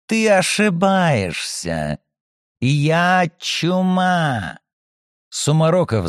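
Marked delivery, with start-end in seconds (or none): 0.1-4.6 slow, menacing, higher voice; predatory menace. Acted. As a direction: savor each word like a predator. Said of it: "Она хищно улыбнулась".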